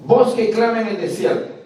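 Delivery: "Voz que clama en el desierto" de corrido, agudo y fuerte; cita proclamada. Shown as a demonstration. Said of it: "se dice así". ("Voz que clama en el desierto" como una sola frase proclamada)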